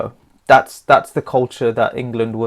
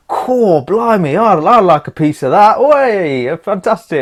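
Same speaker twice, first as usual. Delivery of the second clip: higher, louder hammed-up cockney Henry; Henry impressed; 6 semitones higher, 6 dB louder than usual. Henry the eighth ogles the portrait, higher and louder. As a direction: play Henry ogling a portrait, loud and high. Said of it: "he looked at it and goes".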